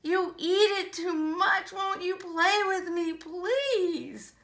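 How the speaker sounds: bouncy rhyming read-aloud; enthusiastic story reading. Acted. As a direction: relish each rhyme for young listeners.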